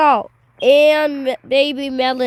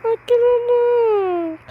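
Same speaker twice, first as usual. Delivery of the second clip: changed voice; 6 semitones higher than usual. Pretend. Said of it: "Your voice changed".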